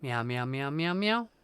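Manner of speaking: high playful meowing; nervous cat voice. Pretend. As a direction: meow like a needy kitten. Meowing back and forth.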